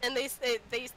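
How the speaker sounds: ordinary talk, nothing marked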